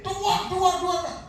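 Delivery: retold cries. Acted cries during head banging.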